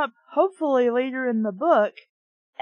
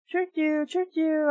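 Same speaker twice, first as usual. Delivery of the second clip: cheering taunt; 5 semitones higher than usual. A narrator's reading. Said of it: "I cheered".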